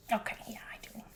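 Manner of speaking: very soft, hushed agreement; quiet assent. Acted. Old Hazel agreeing to give a statement, very quietly. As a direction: agree under your breath.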